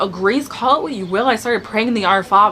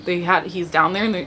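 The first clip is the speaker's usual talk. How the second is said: ordinary talk, nothing marked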